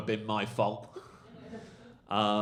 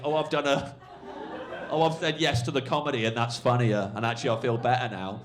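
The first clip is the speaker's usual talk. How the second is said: louder, higher happy relief, easing down; smiling realisation; 4 semitones higher, 6 dB louder than usual. Retold or imitated. Heckler realising he joined the comedy, raised happy relief. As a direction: act out a heckler's mild euphoria. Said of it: "then he was smiling".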